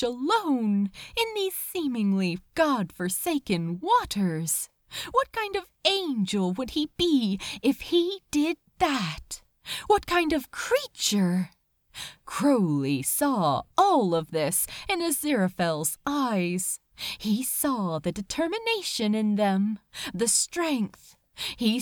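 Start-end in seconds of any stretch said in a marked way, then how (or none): none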